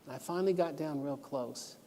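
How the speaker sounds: usual speaking voice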